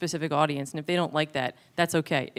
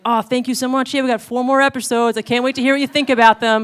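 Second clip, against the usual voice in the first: loud, high, sugary fake thanks; mock sweet; 6 semitones higher, 8 dB louder than usual. To a troll commenter, loud high mock gratitude. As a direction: kill her with kindness, loud and high. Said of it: "fun little troll argument".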